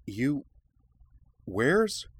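rising, high and bewildered; baffled, searching; confused. A narrator's reading. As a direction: lose the thread mid-question. Confused at sudden disappearance.

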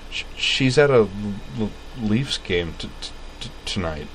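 stammering through it; stutter. A narrator's reading. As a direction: stutter on each start. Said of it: "her stutter".

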